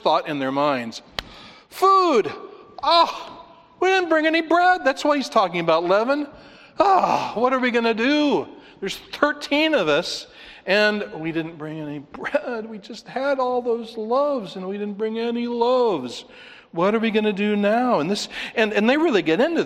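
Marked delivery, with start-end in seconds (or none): 1.7-2.3 blurted, much higher and louder; sudden realization. Acted. Disciples' first thought, acted out. 2.8-18.0 high, loud mock panic; hammed-up panic. Disciples panic over forgotten bread.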